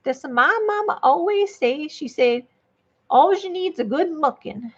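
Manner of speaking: hammed-up Southern lady accent; Southern gentlewoman. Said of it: "Let's turn the Southern gentle".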